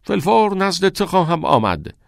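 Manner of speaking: high stage reading from the script; reading lines. A narrator's reading. Todd reading his part aloud from the script. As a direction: read it out as a stage line. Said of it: "از روی متن خواند".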